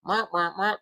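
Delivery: droning mock translator box; translator gadget. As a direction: imitate a little gadget babbling. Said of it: "a little translator, a little box, it would go".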